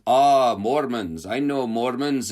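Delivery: slow, loud mock Russian accent; drawn-out imitation of a drunk Russian accent. Drunk gunman greeting two missionaries, slow loud mock Russian accent. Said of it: "a Russian accent".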